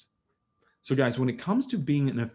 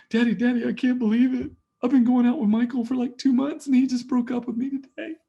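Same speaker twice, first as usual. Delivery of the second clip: put-on high, loud upset teenage girl voice; teen daughter; 12 semitones higher, 5 dB louder than usual. Daughter telling dad about the breakup, high and upset. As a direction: play the heartbroken daughter crying to dad.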